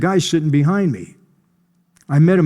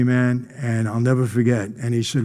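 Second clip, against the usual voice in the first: normal talking voice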